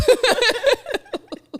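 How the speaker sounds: loud high cackle; high burst of laughter. Real, not acted. Gossiping with a friend, she bursts into a loud high laugh.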